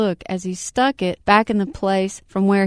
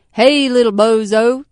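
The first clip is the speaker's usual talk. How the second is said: hearty greeting; 3 semitones higher, 10 dB louder than usual. Acted. Electrician greets dog.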